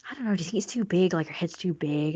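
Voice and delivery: normal talking voice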